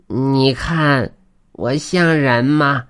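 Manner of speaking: high, very drawn-out creature voice; put-on weasel voice; weasel spirit asking. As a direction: stretch the question slowly and hopefully. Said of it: "黄皮子问道".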